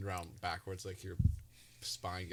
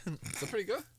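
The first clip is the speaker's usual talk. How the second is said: mock British accent; 11 semitones higher than usual. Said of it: "Let's see my British accent".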